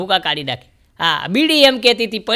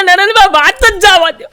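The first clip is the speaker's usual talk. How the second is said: high, loud fed-up complaint; exasperated groom; 8 semitones higher, 7 dB louder than usual. Retold or imitated. Newlywed groom complains to the comedian, high and exasperated. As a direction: wave it off, fed up.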